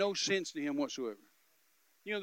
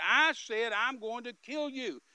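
higher, slow, angry retold threat; raised-pitch menacing insistence; mad; 6 semitones higher, 6 dB louder, slower than usual. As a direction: act out a gunman repeating himself, drawn out. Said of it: "I made him mad".

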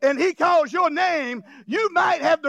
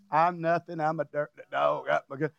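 low, soft grovelling whimper; mock grovelling; 11 semitones lower, 6 dB quieter than usual. Preacher mocks royalty putting itself down, low mock grovel. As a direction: lightly play a king who puts himself down, low. Mocking a king who grovels.

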